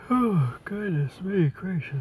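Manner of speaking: words stretched through a big yawn. Real, not acted. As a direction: say it mid-yawn, slow and drawn out. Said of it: "I can't stop yawning".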